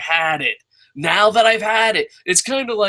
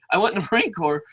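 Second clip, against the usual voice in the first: ordinary talk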